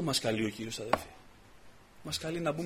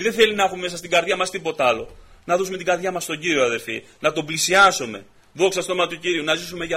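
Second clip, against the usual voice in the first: louder and higher with each repeated line; fervent preaching building; 4 semitones higher, 8 dB louder than usual. Acted. Preacher telling the brothers how much the Lord loves them, building loud preaching. Sermon exhortation continues.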